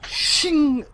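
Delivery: sharp high "shing" sword effect; sword clang sound. Pretend. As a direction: sing out the blade clash.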